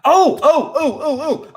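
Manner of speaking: high, excited string of ohs; eager pupil. Pretend. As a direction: beg to be picked, like a keen pupil. Eager to be called on.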